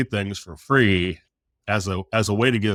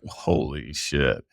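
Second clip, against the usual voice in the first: low, slow, drawn-out amazement; retold stunned disbelief; 9 semitones lower, much slower than usual. Contractor retelling his shock at a rival's contract price, stunned. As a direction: let it out slowly, deep and stunned. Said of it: "just, like, totally blown away".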